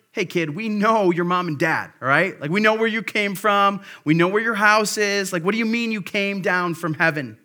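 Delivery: mocking crowd. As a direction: talk down to the upstart kid from down the street. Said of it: "They're like".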